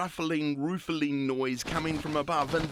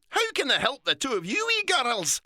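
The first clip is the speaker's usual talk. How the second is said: higher, louder Scottish "So"; old Scottish lady; 10 semitones higher, 4 dB louder than usual. A narrator's reading. Old lady greeting the girls, Scottish accent. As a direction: draw it out in a Scots lilt. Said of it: "said the old Scottish lady".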